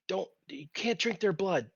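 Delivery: ordinary talk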